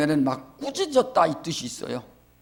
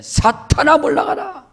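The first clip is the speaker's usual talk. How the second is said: higher, commanding rebuke; Jesus rebuking; 8 semitones higher than usual. Retold or imitated. Pastor voices Jesus rebuking Peter, higher and commanding. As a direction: command Satan to get back. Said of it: "이렇게 하셨다는 겁니다".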